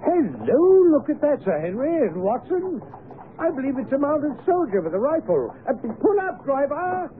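high-pitched, urgent hail; excited calling out. Acted. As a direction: call out to halt the driver. Spots soldier, calls to driver.

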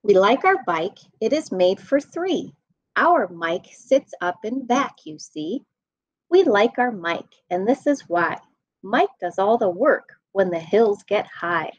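ordinary delivery

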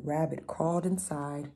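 ordinary delivery

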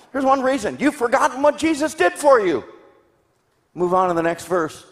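ordinary talk

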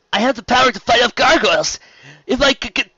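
high, loud and eager boast; insistent protest. A narrator's reading. Spike claiming he can fight gargoyles, insistent protest.

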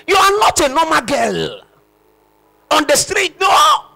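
high, loud urging; preaching shout. Real, not acted. Preacher urging the young woman, loud and high. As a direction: lift the congregation with conviction.

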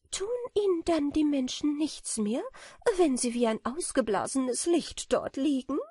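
put-on high, light, dreamy girlish voice; a naive little butterfly imagining honey; sweet and high; innocent butterfly. A narrator's reading. Butterfly asks anxiously.

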